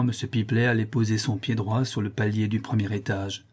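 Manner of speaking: ordinary delivery, nothing marked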